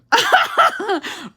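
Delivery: sudden high-pitched laugh; high burst of laughter. Real, not acted. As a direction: laugh out loud, high and bright. Laughs at comment.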